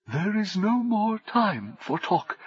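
usual speaking voice

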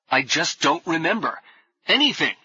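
agitated, pitch going up on "anything"; voice rising. A narrator's reading. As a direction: lose composure as the voice climbs. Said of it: "felt his voice rising, but could not make it sound normal".

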